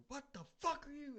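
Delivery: high-pitched startled yelp of a challenge; startled older woman. Retold or imitated. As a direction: imitate a frightened woman confronting an intruder. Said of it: "next thing I know, I hear".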